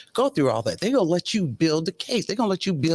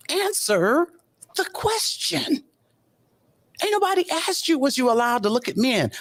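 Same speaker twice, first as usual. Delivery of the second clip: exasperated scolding; 9 semitones higher than usual. Retold or imitated. Host scolding a witness on the stand.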